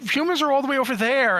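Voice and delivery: high, lightly voiced wife's complaint; a light imitation of the wife pointing it out; imitating wife. Voicing wife in the story.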